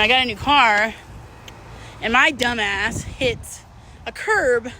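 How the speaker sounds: ordinary talk, nothing marked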